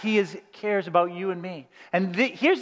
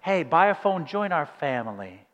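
mock TV ad; 6 semitones lower, 3 dB quieter than usual. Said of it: "the T V is like".

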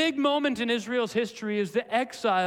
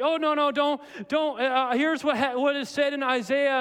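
higher-pitched, stumbling self-correction; mock confused Mark; 6 semitones higher, 4 dB louder than usual. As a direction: act out a flustered backtrack, lightly hammed. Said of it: "It wasn't like he was like".

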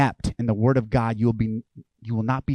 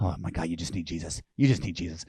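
low, hushed aside; soft and low, as if thinking it privately; inner thought; 7 semitones lower, 9 dB quieter than usual. Retold or imitated. Pastor silently thinking about people he serves, hushed inner thought.

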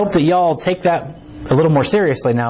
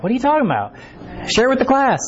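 higher-pitched playful call-out; mock teacher scolding; 10 semitones higher than usual. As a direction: tease the whisperers like a teacher. Teasing whisperers like a teacher.

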